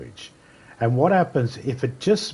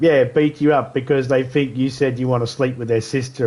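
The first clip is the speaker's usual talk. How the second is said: normal talking voice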